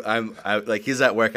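ordinary talk

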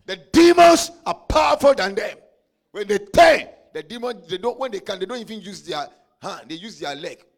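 loud, high, shouted preaching; preaching at full force. Acted. Preacher shouting to the congregation about demons, loud and high. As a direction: hammer it home to the church.

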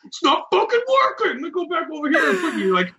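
high, fast, annoyed ranting; mock frustrated rant. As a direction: rush through it fed up with the connection. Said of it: "You hear me, like, filtering through shit".

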